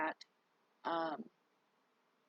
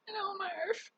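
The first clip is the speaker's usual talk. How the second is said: much higher, whiny voice for the pet; pet scolding; 12 semitones higher than usual. Real, not acted. Telling Marvin off in a high, whiny voice.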